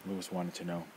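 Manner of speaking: ordinary delivery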